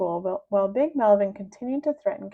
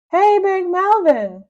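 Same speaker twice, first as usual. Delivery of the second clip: high, loud, slow call; a boy calling out across the scene; shout; 10 semitones higher, 10 dB louder, slower than usual. Acted. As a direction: yell to get his attention. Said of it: "shouted George, huffing and puffing".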